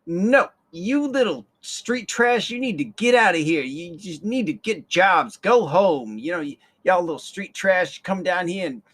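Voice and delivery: loud, high snooty scolding; haughty mock lady telling kids off. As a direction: scold the street kids from above. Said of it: "And she's like".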